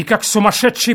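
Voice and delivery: ordinary delivery, nothing marked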